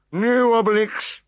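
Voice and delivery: slow, high tired refusal; high slow weary no. A narrator's reading. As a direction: sigh it out, fed up. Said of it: "said Getafix the Druid wearily".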